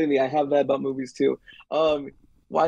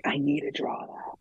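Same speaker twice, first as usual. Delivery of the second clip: inner voice; 8 dB quieter than usual. Retold or imitated. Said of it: "something in me just like".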